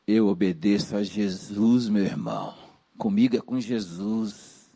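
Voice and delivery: low, hushed, self-important pious voice; super-spiritual believer. Acted. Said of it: "O super espiritual é assim".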